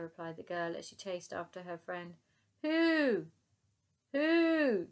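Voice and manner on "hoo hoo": high, loud hooting call twice; playful mammoth hoots. Acted. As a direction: hoot along like the mammoth. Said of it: "joined in Elsie".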